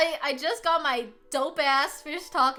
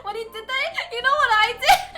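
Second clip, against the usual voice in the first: high, laughing words through giggles; laughing hard; 12 semitones higher, 3 dB louder than usual. Real, not acted. Laughing to the viewers about the date. Laughing while telling the story.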